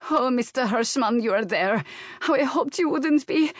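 put-on high, flustered Slavic-accented maid; strong Slavic accent. A narrator's reading. Maid phoning her employer at night, flustered Slavic accent. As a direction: blurt it out in a thick Slavic accent. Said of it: "she said in a rush, her strong Slavic accent muddling her words".

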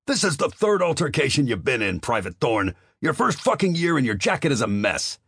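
a commander blowing up at a private; near shout. Acted. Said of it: "and then all but shouted".

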